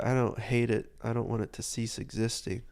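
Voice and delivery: ordinary talk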